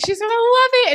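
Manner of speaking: high, loud gush of anticipation; excited. Retold or imitated. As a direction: re-enact bursting excitement at full volume. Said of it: "I was so excited for it".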